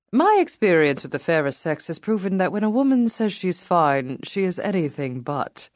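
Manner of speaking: put-on charming British accent, male voice. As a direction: play it as a charming British man. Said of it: "a male voice with a charming British accent".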